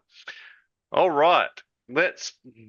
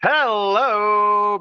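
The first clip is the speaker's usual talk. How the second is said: big greeting; 8 semitones higher, 6 dB louder than usual. Real, not acted. Podcast host's big greeting.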